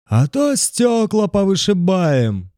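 high, slow rowdy yell; rowdy threat. A narrator's reading. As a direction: bellow it like a hooligan in the crowd.

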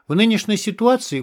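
brisk and upbeat; cheerful. A narrator's reading. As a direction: answer breezily. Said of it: "бодро ответил Феникс".

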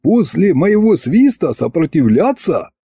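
mildly amused boast; amused scoff. A narrator's reading. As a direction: scoff at the very idea. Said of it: "хохотнул Соловей".

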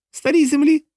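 very high-pitched, disbelieving question; incredulous echo. A narrator's reading. A henchman echoes John's answer, high and incredulous. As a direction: repeat it in startled near-falsetto. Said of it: "повторив один із бугаїв".

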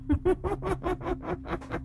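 high ghostly cackle; a witch's shrill cackle from under the wheel; cackling laugh. A narrator's reading. Ghost woman cackles at the old man. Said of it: "nhìn lão cười khanh khách".

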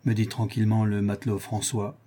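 usual speaking voice

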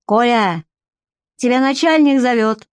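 narrator's mild call-out. A narrator's reading. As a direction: call someone over, lightly projected. Calling the guard over.

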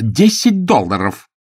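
loud, drawn-out shout; shouted offer. A narrator's reading. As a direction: shout it slowly at a dull driver. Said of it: "она крикнула".

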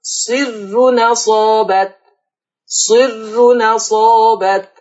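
"صر نصابت" chanted slowly, the vowel moved onto the n; naql recitation. Shown as a demonstration. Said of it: "فتقرأ".

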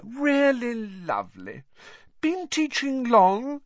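high, slow, sugary; Rita's sugary voice. A narrator's reading. Rita buttering up Hagrid for a story, high and slow. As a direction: drawl it sweetly with false charm.

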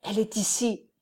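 a soft, trembling voice, barely holding together. A narrator's reading. Renzo tells the old friar she is inside, voice trembling. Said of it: "dit d'une voix tremblante".

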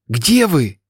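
shouting. A narrator's reading. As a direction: call out for family. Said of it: "закричала". (встревоженный крик)

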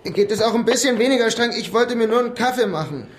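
high, loud, whiny innocent act; playing dumb innocent. Suspect acting harmless to the officers, high whiny plea. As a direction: pitch it up high and loud like a harmless fool caught by cops.

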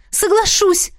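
high, ready agreement; eager exclamation. A narrator's reading. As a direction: burst out agreeing. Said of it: "воскликнула я".

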